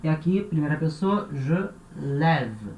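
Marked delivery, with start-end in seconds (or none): 1.3-2.7 "lève" with an open È, as in "bed"; E aberto. Shown as a demonstration. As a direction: stretch "je lève" to show the open È. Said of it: "som de E". ("lève" com E aberto, como em "pé")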